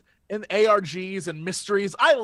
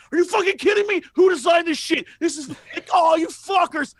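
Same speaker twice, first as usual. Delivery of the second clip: much higher, loud enraged outburst; furious retold rant; 7 semitones higher, 3 dB louder than usual. Streamer reliving rage at the devs, furious shouting. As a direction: rage at the game devs all over again. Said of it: "I was so mad".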